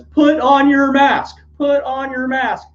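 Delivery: very high, slow mock yell; imitated yelling. Fellow protester yelling at the spitting man, high slow mock shout. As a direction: bark the order at the unmasked shouter. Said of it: "So he's yelling at the guy".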